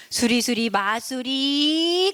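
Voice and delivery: slow incantation rising to the end; slow storybook magic chant; magic spell. Acted. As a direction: draw out the last word, building the spell. Fairy casts a magic spell.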